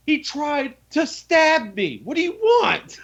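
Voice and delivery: high, slow incredulous protest; lightly played exasperation at being questioned; indignant. Player to bandits asking why he killed, high slow indignant protest. Defending killing Benny to bandits.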